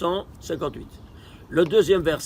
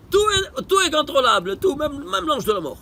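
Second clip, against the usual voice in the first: amazed exclamation; 7 semitones higher than usual. Real, not acted. Teacher marvels at the point.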